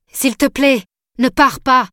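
encore plus aigu et plus fort, « s'il te plaît » supplié; répéter la prière en montant d'un cran, désespéré; pleading harder. A narrator's reading. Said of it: "sa voix plaintive". (Scott supplie encore, plus haut et plus fort, implorant)

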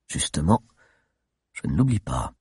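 low, measured comeback; controlled retort. Acted. Chief answering his shouting adviser, controlled. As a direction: drop the pitch and answer firmly.

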